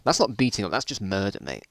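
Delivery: ordinary talk, nothing marked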